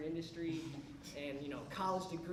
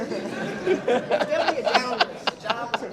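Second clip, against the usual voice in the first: laughing; 4 semitones higher, 14 dB louder than usual. Real, not acted. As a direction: laugh it out while admitting it. Laughing at own story.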